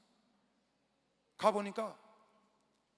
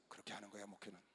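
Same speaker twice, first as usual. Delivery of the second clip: usual speaking voice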